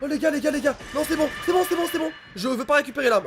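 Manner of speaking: high, frantic panic; panicked. Real, not acted. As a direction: panic and back off. Panic at enemy.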